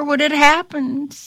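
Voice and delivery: ordinary delivery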